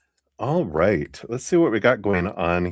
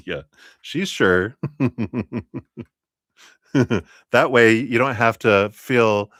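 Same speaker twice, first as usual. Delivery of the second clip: normal talking voice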